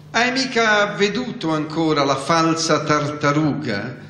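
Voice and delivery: ordinary delivery, nothing marked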